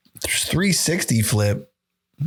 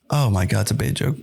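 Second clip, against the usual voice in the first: normal talking voice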